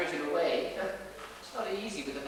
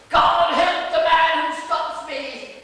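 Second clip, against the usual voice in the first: loud, high theatrical roar, drawn out slowly; crying out; 8 semitones higher, 10 dB louder, much slower than usual. Acted. Dresser re-enacting Sir in the street, crying out. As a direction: bellow it like a stage king. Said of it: "he cried out".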